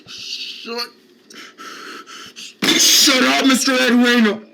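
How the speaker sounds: a put-upon patient protesting his name; whiny protest. Acted. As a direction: wail the denial like a wounded man-child. K Rob character yells back.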